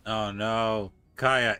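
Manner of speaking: ordinary talk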